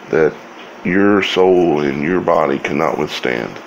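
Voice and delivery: ordinary delivery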